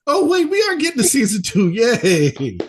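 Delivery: excited. Retold or imitated. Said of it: "to just be like".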